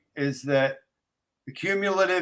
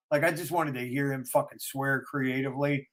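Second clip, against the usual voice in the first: ordinary talk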